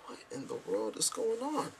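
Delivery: high, soft disbelieving question; baffled. Retold or imitated. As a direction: ask it in quiet astonishment. Incredulous quote after "I'm like.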